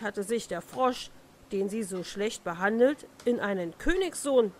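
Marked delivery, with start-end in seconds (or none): none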